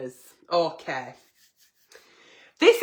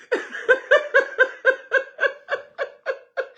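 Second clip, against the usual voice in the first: shrieking laugh; high squealing laugh. Real, not acted. Host cackling at a viewer's comment, squealing laugh. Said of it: "laughs at comment".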